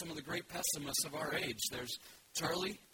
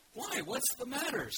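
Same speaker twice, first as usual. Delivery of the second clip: high, bright little bear voice; cheerful Pooh; 9 semitones higher, 4 dB louder than usual. Acted. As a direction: greet him like a happy little bear.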